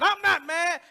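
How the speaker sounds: mock angry denial, high and loud; indignant protest acted out for the congregation; defensive denial. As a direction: snap back that you're not mad, hammed up. Acted quarrel about denying anger.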